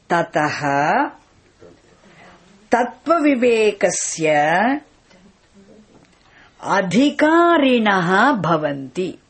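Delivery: "tataha", "tattva vivekasya", "adhikarinaha bhavanti" split by clear pauses; words broken apart. Shown as a demonstration. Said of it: "If you break it".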